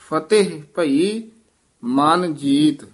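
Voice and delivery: ਫਤਿਹ with the short i vowel, said slowly; clear ਫਤਿਹ. Shown as a demonstration. Teacher corrects a reading of ਫਤਿਹ. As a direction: sound the sihari in ਫਤਿਹ, not ਫਤਹਿ. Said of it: "ਇਹ ਫਤਿਹ ਉਚਾਰਨ ਕਰਨਾ".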